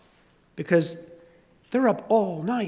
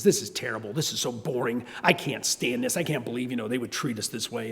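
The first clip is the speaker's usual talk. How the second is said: low, softer sulky grumble; sulking kid; 7 semitones lower, 8 dB quieter than usual. Retold or imitated. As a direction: Calvin grumbling to Hobbes outside. Calvin sulking to Hobbes.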